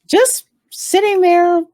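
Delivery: ordinary talk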